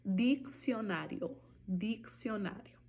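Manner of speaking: dicionário said slowly with K-S; slow Spanish diccionario. Shown as a demonstration. Teacher drilling dicionário slowly for students. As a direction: stretch out dicionário, KS clear. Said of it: "som de K".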